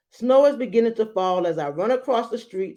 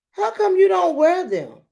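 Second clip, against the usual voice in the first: put-on curious boy; 12 semitones higher, 5 dB louder than usual. Antonio asks about shoes.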